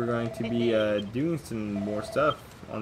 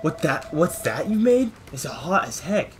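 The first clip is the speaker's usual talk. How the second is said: higher, louder character voice; 5 semitones higher, 5 dB louder than usual. Acted. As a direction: play the worried companion. Voices character in game dialogue.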